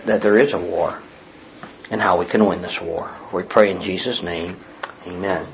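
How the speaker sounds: low, soft, earnest prayer; pastor's prayer. Acted. Pastor asking God to teach his people, soft low prayer. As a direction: pray quietly and reverently, pitched low.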